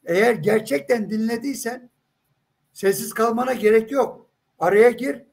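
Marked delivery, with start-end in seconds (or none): none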